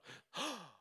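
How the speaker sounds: sharp fast inhale of surprise; quick surprised gasp. Shown as a demonstration. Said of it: "Do that really fast".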